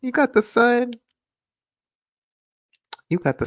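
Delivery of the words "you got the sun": much higher sing-song reading; playful high-pitched take. Shown as a demonstration. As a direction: lift it way up high. Repeats line in different voices.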